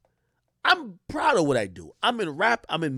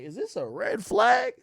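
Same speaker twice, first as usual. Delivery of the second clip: wary; slower than usual. Retold or imitated. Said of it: "low-key like".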